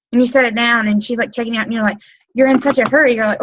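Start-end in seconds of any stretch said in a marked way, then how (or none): none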